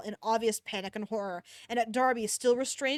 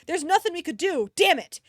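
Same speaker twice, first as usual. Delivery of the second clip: angry outburst; 6 semitones higher, 7 dB louder than usual. Acted. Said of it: "in anger".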